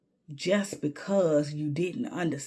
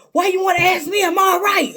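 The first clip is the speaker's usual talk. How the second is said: high, loud, offended snap; a hammed-up indignant retort; mock indignant shout; 11 dB louder, faster than usual. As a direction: throw it back at her, outraged. Retelling a man's hostile reply.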